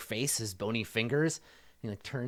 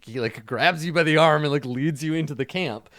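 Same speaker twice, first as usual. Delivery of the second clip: usual speaking voice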